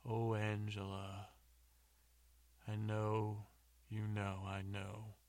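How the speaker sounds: drawn-out, chanted mock love poem; terrible love poetry. James keeps going with his love poetry, slow and mock-romantic. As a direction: intone each short phrase, pausing between them. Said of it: "keeps going".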